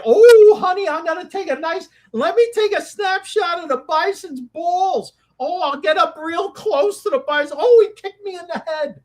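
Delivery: high-pitched, gushing parody of a reckless tourist; mock tourist voice. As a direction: play a silly tourist in an exaggerated high voice. Mocks bison tourists getting too close.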